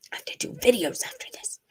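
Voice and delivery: soft, teary whisper; a hushed, tearful grumble at Heath. Real, not acted. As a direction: breathe it out, still choked up. Moved to tears by Heath.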